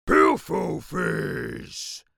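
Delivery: big slow creature's shout; Bloodbottler. A narrator's reading. Creature scoffing at the BFG, shouting. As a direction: bellow it, dragging out each word. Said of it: "shouted the blood bottler".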